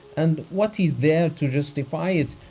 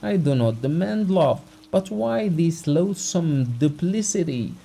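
raised, pleading voice near tears; tearful heartfelt plea; 6 semitones higher, 4 dB louder than usual. Acted. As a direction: pour out the heart, hurt and wronged. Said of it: "With tears in his eyes, trembling all over".

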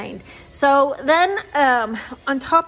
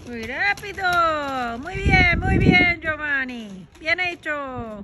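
high, bright encouraging praise; 3 semitones higher than usual. Real, not acted. Praising her son in Spanish.

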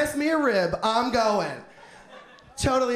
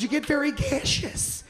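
usual speaking voice

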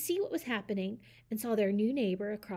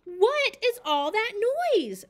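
high, loud shout; 11 semitones higher, 10 dB louder than usual. Acted. Mister Owl shouts at the noisy neighbors. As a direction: yell it out, fed up. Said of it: "shouted Mister Owl".

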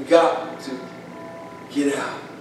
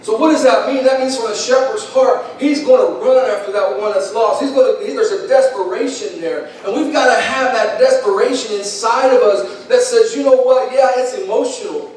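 full-force preaching; 8 semitones higher than usual. Acted. Preacher pleads with the congregation, urgent and raised.

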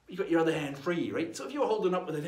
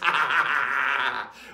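loud staccato guffaw; pirate laugh; 5 semitones lower, 8 dB louder than usual. Acted. Pirate after leading the cheer, loud guffaw. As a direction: roar out a hearty pirate laugh.

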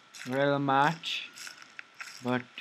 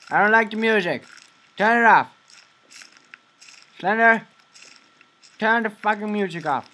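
louder and higher, telling the game off; annoyed yelling; 6 semitones higher, 6 dB louder than usual. Real, not acted. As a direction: shout at the music to stop.

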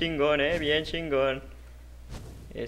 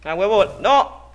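sudden shout, higher and louder; startled yelp; 5 semitones higher, 7 dB louder than usual. Real, not acted. Gamer caught off guard in the match, startled shout. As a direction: cry out in alarm.

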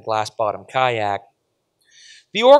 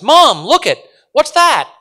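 put-on high, loud, urgent child's cry; girl yelling, pitch leaping up; shouting; 12 dB louder, slower than usual. A narrator's reading. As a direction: shout to mom in alarmed wonder. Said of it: "Judy shouts".

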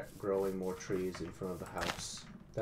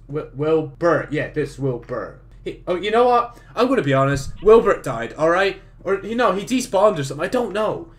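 higher, louder hyped channel-host voice throughout; energetic YouTuber intro; 6 semitones higher, 11 dB louder than usual. Acted. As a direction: pump up the viewers like opening a new video. New recorded intro as the Night Flyer.

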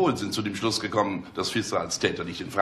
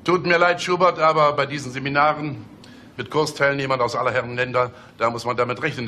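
mock concern; 6 semitones higher, 5 dB louder than usual. Mock-sympathetic boss scaring employee.

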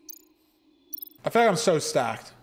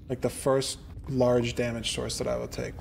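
ordinary delivery

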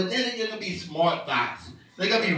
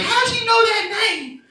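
mock angry wife; 3 dB louder than usual.

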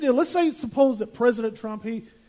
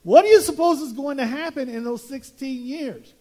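ordinary talk

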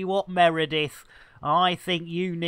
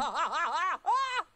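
high laugh; 10 semitones higher, 9 dB quieter than usual. Real, not acted.

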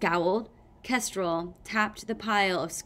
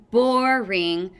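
loud, bored heckle; shouted; 2 semitones higher, 8 dB louder than usual. A narrator's reading. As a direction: call it out over the squabbling. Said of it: "Clay shouted from his pile of rocks".

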